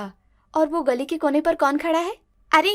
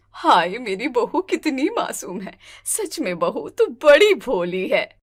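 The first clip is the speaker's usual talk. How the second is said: slow, lower doting voice; fond teasing; 4 semitones lower, slower than usual. Acted. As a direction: coo over the innocent bride, amused. Mother-in-law teasing innocent bride.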